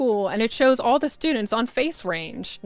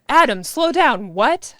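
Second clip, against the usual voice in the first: loud, higher baffled question; raised voice, thrown and puzzled; completely confused; 5 semitones higher, 6 dB louder than usual. A narrator's reading. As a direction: ask it in total confusion. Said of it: "completely confused".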